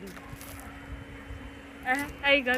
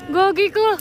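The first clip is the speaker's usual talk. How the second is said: mock alarmed shout; 3 semitones higher, 5 dB louder, slower than usual. Mock panic at crying friend.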